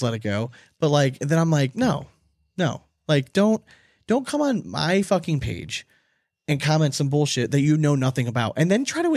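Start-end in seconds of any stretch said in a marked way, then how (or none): none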